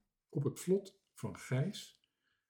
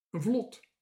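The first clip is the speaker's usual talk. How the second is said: plain reading voice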